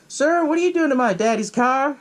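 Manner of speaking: high mock child voice; little kid voice. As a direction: ask like a small curious kid. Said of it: "he's like".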